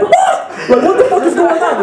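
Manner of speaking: squeaky high startled chicken voice. Retold or imitated. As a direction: squawk it as a baffled chicken. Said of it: "The chicken's like".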